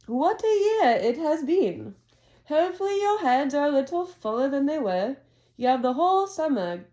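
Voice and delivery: a hint of a grand old headmaster; Dumbledore voice. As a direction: read it like a genial old wizard addressing the hall. Dumbledore speech read aloud.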